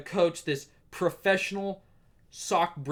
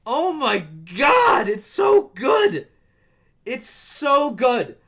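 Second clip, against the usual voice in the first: much higher and louder, slow shout; excited shout; 9 semitones higher, 9 dB louder, much slower than usual. Real, not acted. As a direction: yell it with joy. Shouting praise of the show.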